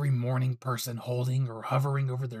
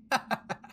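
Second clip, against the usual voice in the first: breathy mocking laugh. Captor laughs at the rescuer.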